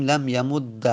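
"لم یمد" ending in doubled d plus a; eloquent fatha ending. Shown as a demonstration. Teacher showing the most eloquent form, "یمد" said yamud-da. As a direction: finish "لم یمد" on a clear "da". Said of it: "اما فصیحش".

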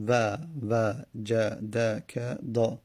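و وجدک دا drawn out slowly; teacher sounding out the phrase; slow demo. Shown as a demonstration. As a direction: count the vowels in و وجدک دا. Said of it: "شش حرکت پشت سر همه".

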